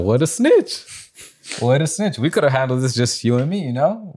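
high-pitched indignant griping; mock indignation. Retelling his reaction to being reported, indignant.

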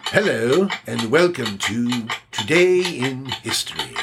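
lightly voiced stately TV host; a hint of a refined presenter; Masterpiece Theatre host. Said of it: "give me the Masterpiece Theater guy".

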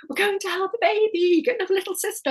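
sung-out high with joy; dance around the kitchen telling your son; excited announcement. Retold or imitated. Mother telling Marcus the baby news, overjoyed. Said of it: "dancing around the kitchen saying".

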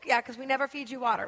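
usual speaking voice

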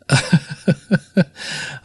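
breathy chuckle at own joke; real, soft chuckle in a string of short puffs; laugh. Real, not acted. Laughs at own pompous-American joke.

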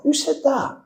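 high, bewildered who said that; startled question. Retold or imitated. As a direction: ask it as if spooked by a voice from nowhere. Startled by unseen voice.

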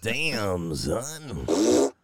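drawn-out meme-style "Damn, son"; a hint of a hype-track drop; damn son catchphrase. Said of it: "was that you doing the damn son".

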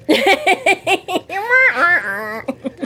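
high, drawn-out sour trumpet noise; comic off-key brass wail; out-of-tune trumpet imitation. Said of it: "this one out-of-tune trumpet".

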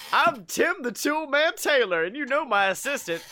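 high, booming show-host introduction; hammed-up TV host, loud on the last name; Tim the Tool Man Taylor. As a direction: announce yourself and your assistant with a big finish. TV host introduces himself in the story.

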